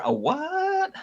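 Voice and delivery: high, startled little "a what?"; mock surprised. Quoting the film to friends, mock surprised. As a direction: squeak it out like a baffled character. Mock confused movie line.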